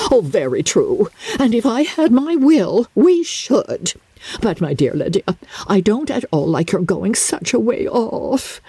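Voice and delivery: put-on high, plaintive old mother; fretful mother. Mrs Bennet to Lydia, high and plaintive. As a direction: whine it out like a fussy mamma.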